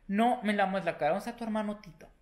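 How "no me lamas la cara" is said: louder pleading no; laughing protest. Real, not acted. Telling the dog to stop licking, loud pleading. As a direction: push the dog away with a loud plea.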